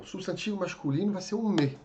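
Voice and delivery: ordinary delivery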